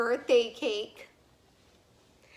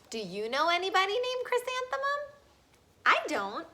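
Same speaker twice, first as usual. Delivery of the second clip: playful aside; 8 semitones higher, faster than usual. Real, not acted. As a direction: tease the listeners lightly. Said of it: "aside to listeners, higher, fast".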